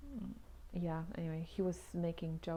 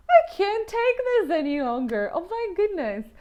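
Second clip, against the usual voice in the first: loud, fed-up outburst, higher; exasperated outburst; 10 semitones higher, 14 dB louder than usual. Retold or imitated. Leaving the building with her coffee, loud and fed up. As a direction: let it burst out, done with it all. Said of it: "And I'm like".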